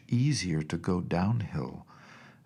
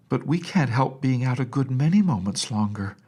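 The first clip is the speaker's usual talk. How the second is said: put-on higher, louder childish protest; child's retort; 5 semitones higher, 5 dB louder than usual. A narrator's reading. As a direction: point out the obvious. Child answers nurse.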